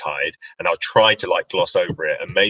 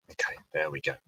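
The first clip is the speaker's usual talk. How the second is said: ordinary talk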